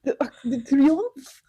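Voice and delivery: slow mock French The Arc de Triomphe; drawn-out hammed-up French accent. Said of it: "say these in your best French accent".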